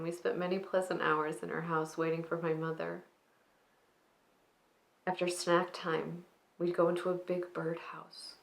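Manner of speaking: soft, hushed dramatic monologue with long pauses; monologue de souvenir endeuillé. Acted. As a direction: confide a grandmother's story quietly, letting sentence endings sink away. Performs a reflective, grieving monologue.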